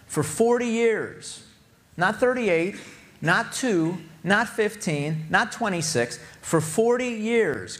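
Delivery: preaching. Acted. Pastor pressing the point to his congregation, impassioned preaching. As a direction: lift the sermon to full fervour.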